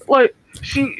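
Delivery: ordinary talk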